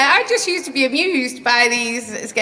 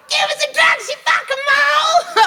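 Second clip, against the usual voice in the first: high shouted mock yob, hammed up; rough local heckler. Patient mimicking a rough local shouting for a cigarette. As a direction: bark it like a rough local cadging a smoke. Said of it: "they'd be like".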